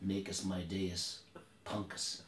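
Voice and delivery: low, slow tough-guy menace; mock cowboy one-liner, quiet and unhurried; Clint Eastwood. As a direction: deliver it very softly, drawn out and deep. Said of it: "How did Clint Eastwood hear this story?".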